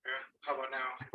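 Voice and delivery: very faint, far from the mic; speaking too quietly to be heard; barely audible. Real, not acted. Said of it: "we cannot hear you".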